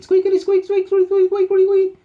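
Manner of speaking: very high, loud toy squeaks; squeaky toy imitation. Dog chewing its toy, high piercing squeaks.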